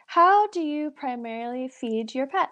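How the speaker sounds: usual speaking voice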